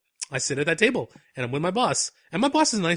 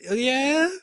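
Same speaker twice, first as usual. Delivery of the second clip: doubtful agreement; 9 semitones higher, 4 dB louder than usual. Retold or imitated. Doubtful reply to baby pictures.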